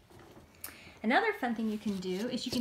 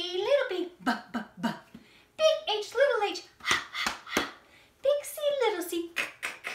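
high-pitched insect voice; 13 semitones higher, 6 dB louder than usual. Shown as a demonstration. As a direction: squeak the letters like a tiny insect. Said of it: "Let's do with our insect voice".

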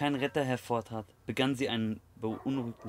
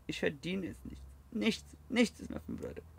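tearful; 7 semitones higher, 5 dB quieter than usual. A narrator's reading. Kane on the verge of tears.

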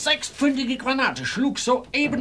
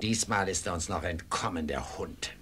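much lower, much softer bitter muttering; low grumble; 9 dB quieter than usual. Acted. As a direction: grumble it under your breath. Defeated pursuer grumbles.

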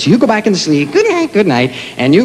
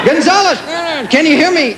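calling out; 11 semitones higher than usual. Acted. Calling into guitar.

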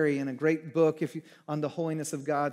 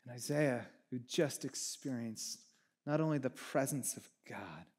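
ordinary talk

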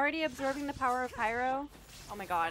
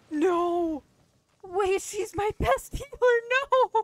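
loud, high cry of dismay; dismayed shout; 10 semitones higher, 12 dB louder than usual. Real, not acted. Gamer shouting in dismay at losing her healer. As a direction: yell no as your best healer dies. Best healer just died.

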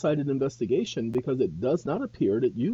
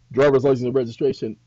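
mock officer barking a demand, fast; quick, louder cop voice demanding papers; officer's demand; 8 dB louder, faster than usual. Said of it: "Then if he continues".

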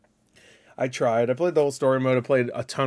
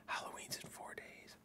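whisper; 28 dB quieter than usual. Real, not acted. Aside to viewers in reaction video.

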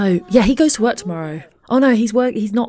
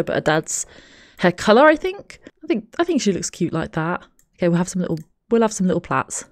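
normal talking voice

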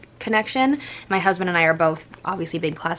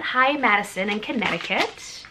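ordinary delivery

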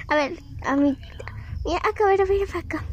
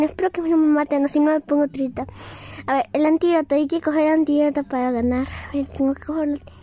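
ordinary delivery

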